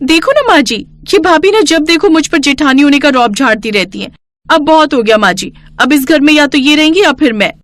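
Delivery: put-on spoilt young bride. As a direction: play the haughty daughter-in-law tattling.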